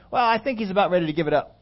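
quick, dry dad punchline; deadpan dad. Retold or imitated. Dad answering the mom, dry deadpan quip. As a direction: toss off the punchline drily. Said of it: "he looks up at her and he goes".

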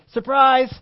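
loud, high mock shout of Surprise; party shout. Guests jump up and yell at the arriving guest, loud and high. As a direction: burst out like a crowd springing a surprise.

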